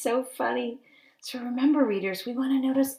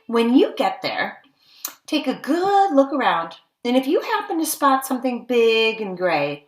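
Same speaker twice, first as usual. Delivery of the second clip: louder, lively read-aloud; storybook narration; 5 dB louder than usual. Acted. Teacher reads the elephant hunt page aloud, louder and lively. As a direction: read it out with adventure in your voice.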